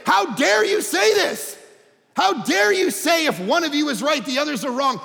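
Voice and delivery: indignant students shouting at the panel; outraged students. Retold or imitated. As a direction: voice the angry protest. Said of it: "line up at the microphones and say".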